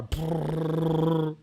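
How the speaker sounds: long rolled machine-gun noise; imitated gunfire. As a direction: trill a high burst of gunfire. Imitates gunfire after "Open fire.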